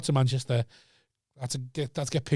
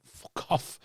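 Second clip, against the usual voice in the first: muttered fuck off; 8 dB quieter than usual. Retold or imitated. Retold outburst at train manager.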